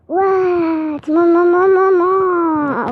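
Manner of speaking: playful high, loud wail for mom; high-pitched voice. Pretend. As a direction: cry out high and drawn out, hamming it up. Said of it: "ça doit être drôle avec la voix aiguë". (cri aigu et exagéré)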